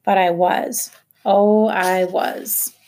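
slow, forced through clenched teeth. A narrator's reading. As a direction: push the words out through locked jaws. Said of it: "I said through clenched teeth".

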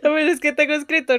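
fast, laughing excuse. Real, not acted. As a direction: laugh while explaining the mistake. Laughing at own slip.

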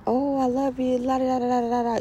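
high, gushing sing-song mimic of a partner in love; mock lovey-dovey partner. Mocking a partner who sweet-talks after hiding the phone, high gushing mimic.

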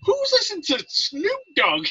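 high, slow disbelieving question; drawn out in amazed disbelief; astonished. Retold or imitated. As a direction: coworker walking in on the rap. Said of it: "he's like".

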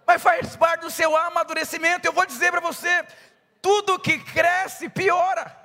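loud, high fervent sermon delivery; full-force preaching. Acted. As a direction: preach it out to the crowd with fire.

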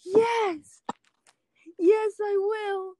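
high, slow, thrilled yes; elated. Acted. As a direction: gasp out a yes. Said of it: "we're gonna role play".

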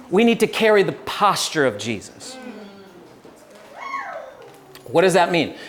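usual speaking voice

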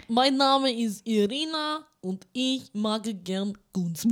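slow mock Russian accent; lightly put-on Russian accent. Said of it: "kannst dich als".

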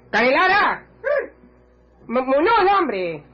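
high, carrying call to his helpers. Acted. Calling helpers to come.

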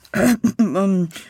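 preparatory cough-like ahem; throat clearing. A narrator's reading. Lawyer about to address the judges, ahem. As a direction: clear your throat before a speech.

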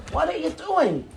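lightly voiced angry man; mock confrontation. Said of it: "He got in my face".

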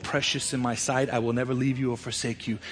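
hushed voice of a loving Father; tender promise. Pretend. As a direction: speak it like a quiet blessing. Quoting God's tender promise.

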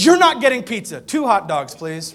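loud, exasperated put-down with a laugh; dad insisting on hot dogs; firm loud refusal. Retold or imitated. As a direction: end the argument firmly. Dad firmly refusing son's pizza.

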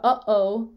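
"uh-oh" with a clear catch between the syllables; glottal stop. Shown as a demonstration. Teacher giving a familiar example, glottal stop. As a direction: stop the throat sharply between "uh" and "oh". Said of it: "glottal stops".

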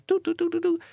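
very high, piping doo-doo-doo; cartoonish high running noise; running sound effect. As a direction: imitate scampering with high-pitched little doos. Imitating the zebra running.

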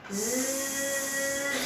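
soft drawn-out buzz; bee voice. Shown as a demonstration. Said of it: "suara lebah".

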